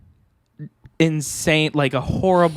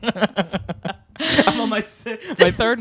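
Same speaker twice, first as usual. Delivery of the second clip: giggling mid-sentence; laughing; 7 semitones higher, much slower than usual. Real, not acted. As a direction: laugh through the words. Joking about third nose.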